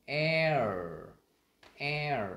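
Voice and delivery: "air" drawn out, gliding from e into r; blended vowel demo. Shown as a demonstration. Teacher joining e and er, long gliding demo. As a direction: stretch "air" loudly so the r colour is heard. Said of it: "và er mình sẽ ra là".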